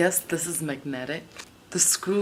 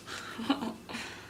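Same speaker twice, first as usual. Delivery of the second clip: soft, high little laugh; short laugh; 7 semitones higher, 7 dB quieter than usual. Real, not acted. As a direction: laugh lightly after your own outburst. Laughs after shouting the chant.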